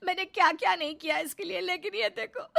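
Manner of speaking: wife fake-sobbing to her husband, high and loud; fake crying. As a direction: whine to the husband with crocodile tears. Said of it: "बीवी के झूठे आंसू".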